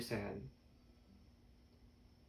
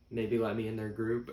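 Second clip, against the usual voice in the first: ordinary delivery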